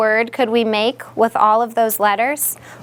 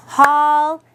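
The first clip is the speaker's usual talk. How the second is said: normal talking voice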